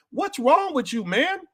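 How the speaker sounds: high, pleading exasperation; exasperated. Real, not acted. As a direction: ask it like you can't believe them. Exasperated at listeners.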